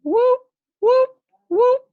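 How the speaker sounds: very high, loud siren whoop; whooping siren imitation; siren wail. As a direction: wail like a fire engine siren. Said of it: "put on the siren".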